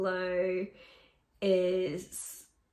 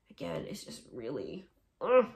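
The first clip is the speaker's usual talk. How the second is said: whispered love; 9 semitones lower than usual. Real, not acted. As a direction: breathe it out fondly. Whispered gush.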